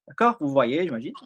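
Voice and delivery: normal talking voice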